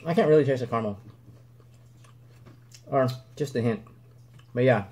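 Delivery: words around a bite; talking while chewing. Real, not acted. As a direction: mouth partly full. Seen 1: Man held granola bar earlier; jaw still working, mouth half-closed, tasting.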